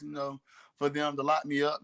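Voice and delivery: ordinary talk, nothing marked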